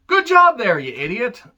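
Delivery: high, loud mock congratulation dropping on the insult; sarcastic praise. Reviewer mocking a singer for his conduct, sarcastic praise. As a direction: jeer with over-the-top false praise.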